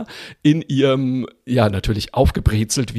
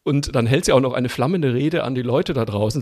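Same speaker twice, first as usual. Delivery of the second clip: usual speaking voice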